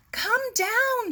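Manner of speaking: very high, loud call; high urgent call up the cliff face. A narrator's reading. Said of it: "she called".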